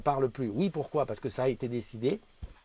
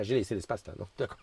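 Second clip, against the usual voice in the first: ordinary talk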